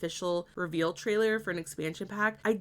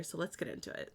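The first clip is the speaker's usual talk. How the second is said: breathy hush into the trailer; whispered let's get into it; 4 semitones lower, 12 dB quieter, faster than usual. Real, not acted. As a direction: drop to a whisper to start.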